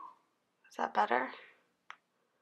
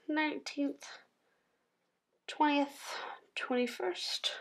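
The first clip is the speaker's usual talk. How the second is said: soft, higher, half-whispered counting; under the breath; 6 semitones higher, 7 dB quieter, slower than usual. Real, not acted. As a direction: count quietly to yourself as you write. Counting dates while writing them in.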